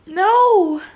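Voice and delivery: high, loud drawn-out cry; distressed cry. Real, not acted. Reader reacting to the action scene, distressed cry. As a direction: cry out in disbelief. Shocked refusal of a plot turn.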